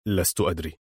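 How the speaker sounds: low, soft, baffled mumble; puzzled murmur. A narrator's reading. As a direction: murmur it, at a loss. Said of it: "وهو يغمغم في حيرة مماثلة". (غمغمة حائرة)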